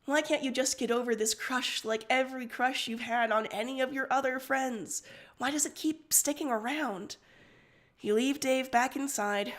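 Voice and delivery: ordinary delivery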